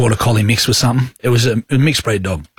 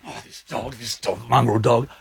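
retold cursing under the breath; grumbling to self; 6 dB quieter than usual. Woman pacing round her ute, grumbling quietly about the dog. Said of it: "I was going pacing".

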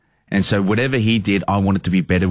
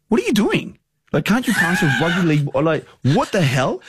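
high, incredulous "what are you doing"; mock disbelief; 8 semitones higher than usual. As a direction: act baffled by their pass. Retelling baffled teammates, mocking.

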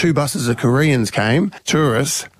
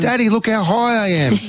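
put-on high-pitched child voice, showing off; proud little girl; 10 semitones higher than usual. Daughter shows dad her climb, proud kid imitation. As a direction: mimic a small kid calling up to dad.

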